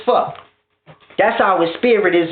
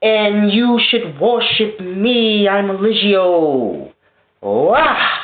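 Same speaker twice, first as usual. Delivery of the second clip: very slow, drawn-out self-worship; grandiose rambling; 2 semitones higher, much slower than usual. Retold or imitated. Eligio rambling in the garden, slow grandiose drawl. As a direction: stretch each word like a dazed prophet. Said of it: "the rambling man that's in the garden like".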